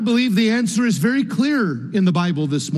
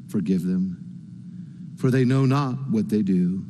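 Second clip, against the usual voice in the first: solemn hushed plea; 6 semitones lower, 6 dB quieter than usual. Acted. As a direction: let each phrase of the prayer sink in quietly. Preacher voices Jesus praying on cross.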